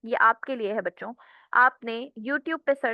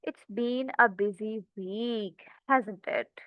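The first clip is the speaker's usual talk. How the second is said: softer, slower "It's been a busy week, hasn't it?" with a falling tone; low, concerned tone; 4 dB quieter, much slower than usual. Shown as a demonstration. Teacher demoing a reply to a stressed friend, soft and concerned. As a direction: sympathize with someone in distress. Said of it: "tone मेरी थोड़ी सी लो होगी".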